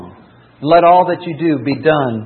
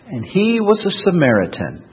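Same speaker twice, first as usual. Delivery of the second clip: ordinary delivery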